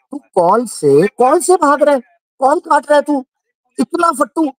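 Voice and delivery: high mocking sing-song taunt. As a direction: mimic him taunting a coward, pitched high. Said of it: "ऐसे बात करता था". (ऊँची आवाज़ में चिढ़ाते हुए नकल)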